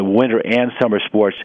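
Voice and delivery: ordinary delivery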